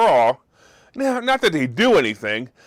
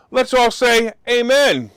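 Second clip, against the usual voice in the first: mock preacher call; 5 semitones higher, 4 dB louder, slower than usual. Preacher-style call to prayer.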